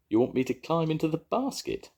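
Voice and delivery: high, worried little dragon; a touch of nervous pleading; anxious. A narrator's reading. The dragon dreading the cat basket, mildly anxious. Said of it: "asked the dragon anxiously".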